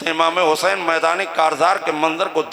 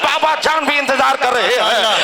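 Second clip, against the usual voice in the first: raised, high-pitched pulpit declamation; impassioned preaching; 6 semitones higher than usual. Acted. Preacher voices nephew and uncle before the last battle, impassioned preaching. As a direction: carry the dialogue in a ringing preacher's pitch. Preaches the dialogue at full force.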